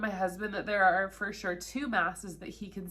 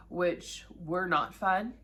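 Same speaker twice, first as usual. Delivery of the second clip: ordinary talk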